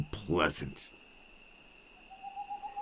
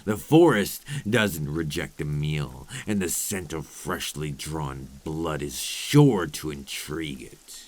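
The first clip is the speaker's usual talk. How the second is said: ordinary delivery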